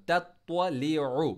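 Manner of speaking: तत्वलिउ slow with a thick heavy त्व; heavy twa. Shown as a demonstration. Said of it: "आइन को middle throat से पढ़ा". (तत्वलिउ में त्वा मोटा)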